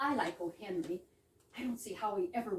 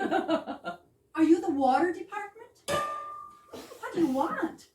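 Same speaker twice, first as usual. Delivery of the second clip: laughing through a higher, louder question; 8 semitones higher, 6 dB louder, slower than usual. Acted. Woman laughing at the banging pipes. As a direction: giggle at the noisy pipes.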